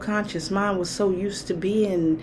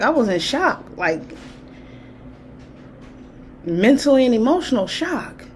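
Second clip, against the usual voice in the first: usual speaking voice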